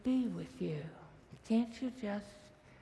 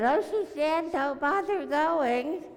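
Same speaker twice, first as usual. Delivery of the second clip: mourners' dismissive warning; 6 semitones higher, 6 dB louder than usual. Retold or imitated. Mourners telling Jesus to stop.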